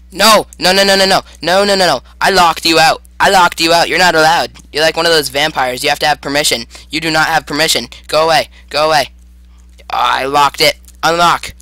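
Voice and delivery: rapid-fire loud scolding, insistent; loud protest. Real, not acted. Player protesting as monster breaks in, loud protest. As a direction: shoo an intruder away, loudly. Monster gets through a locked door.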